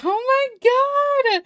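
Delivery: high, drawn-out delighted cry; thrilled gasp. Real, not acted. Streamer seeing fan art for the first time, high thrilled cry. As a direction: squeal it out slowly in joy.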